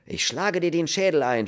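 high, loud fury; shouted threat. A narrator's reading. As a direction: threaten him with violence. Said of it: "schrie er". (wütend geschrien)

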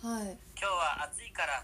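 slowly spelled out standard Japanese; slow standard version. Shown as a demonstration. Explaining dialect to a listener, slow standard phrase. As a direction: read it slowly as the standard form. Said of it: "ってなったら".

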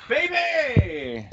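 high-pitched wrestler catchphrase call; Adam Cole Baby. Retold or imitated. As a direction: imitate the ring shout. Imitating Adam Cole's catchphrase.

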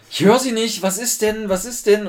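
high, loud can't-hear-you call; straining to hear a caller; loud operator voice. Retold or imitated.

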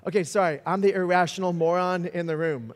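normal talking voice

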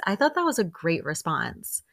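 ordinary delivery

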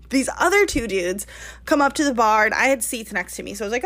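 ordinary talk

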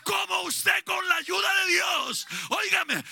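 loud, high preaching shout; fiery preacher shouting to his fellow ministers; full-force preaching. Acted.

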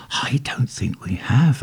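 whispered, panic held down; hushed, hidden panic. A narrator's reading. Narrator whispers to the stranger, panic barely held down. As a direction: fight to keep panic out of the whisper. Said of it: "trying to keep a note of panic out of my voice".

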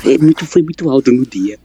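ordinary talk

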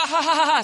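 loud staged laugh; mock laughter. Preacher imitating people laughing for joy, loud mock laugh. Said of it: "Sabi nung iba".

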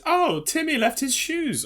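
high-pitched imitation of the boss's wife; high, airy mock housewife voice. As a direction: put on a high voice for the wife at the door. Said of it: "she goes".

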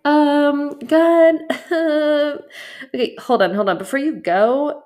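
hammed-up panic stopping God before he leaves; flustered call to God. Believer halting God mid-exit, loud and flustered. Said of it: "And we're like".

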